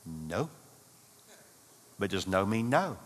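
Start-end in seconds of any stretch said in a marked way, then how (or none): 0.1-0.5 a clipped, much softer and lower no; sulky quiet no. Pretend. Wife sulking at her husband, low quiet no. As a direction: drop it low and quiet, shutting him out. Wife's sulky one-word answer.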